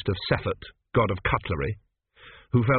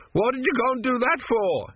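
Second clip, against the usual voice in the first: high-pitched outraged protest; spluttering victim's indignant cry; indignant demand; 12 semitones higher than usual. A narrator's reading. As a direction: demand an answer, affronted. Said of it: "he demanded".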